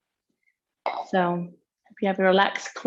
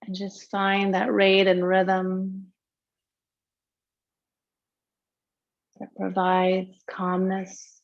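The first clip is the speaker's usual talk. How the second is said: guided meditation. Real, not acted. Yoga teacher settling students into tonight's practice, guided meditation. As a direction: lead them gently into breath awareness, unhurried.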